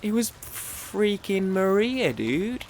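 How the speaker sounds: high, slow, drawn-out amazement; excited reveal. Real, not acted. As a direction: stretch it out in disbelief. Reveals surprise chat guest.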